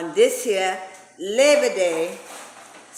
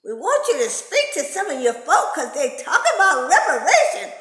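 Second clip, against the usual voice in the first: high, loud, panicky official; Mister Enforcer voice; 6 semitones higher, 4 dB louder than usual. Retold or imitated. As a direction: squeal it out like a nervous boss. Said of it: "Mister Enforcer say".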